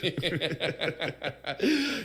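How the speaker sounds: real high-pitched laughter; laughing. Real, not acted. Storyteller cracking up at co-host's impression, laughing. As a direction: break up laughing.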